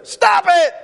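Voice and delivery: high, loud exasperated yell; shouted stop. Retold or imitated. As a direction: snap at her, fed up, at full volume. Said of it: "he just yells at her".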